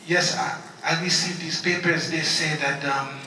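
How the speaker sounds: imitating a Haitian mother's accented English; softly spoken, hesitant Haitian-accented phone voice; confused plea in a thick Haitian accent; deep Haitian accent. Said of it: "my mom has a deep accent".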